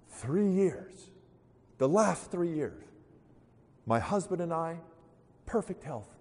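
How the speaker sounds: high-pitched, slow, plaintive woman; hammed-up grief with long pauses; acted lament. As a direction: lament as if confessing a tragedy. Woman laments her perfect life.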